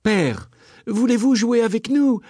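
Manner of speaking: put-on high eager child call; children calling out. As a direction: call to father, pleading to play. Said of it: "s'écrièrent alors les deux enfants".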